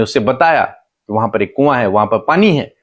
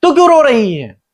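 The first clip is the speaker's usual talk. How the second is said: high, raised questioning voice; angel calling to Hagar; 10 semitones higher, 3 dB louder than usual. Retold or imitated. Angel asks weeping Hagar what happened, high raised questioning. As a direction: call out each question high, then let it fall. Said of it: "स्वर्गदूत उससे कहते हैं कि".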